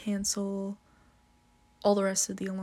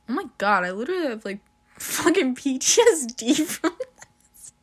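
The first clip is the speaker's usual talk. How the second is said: louder, higher, laughing exclamation; real laughing disbelief; laughing outburst; 4 semitones higher, 8 dB louder than usual. Real, not acted. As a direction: burst out laughing about the trauma. Laughing about the screaming alarm.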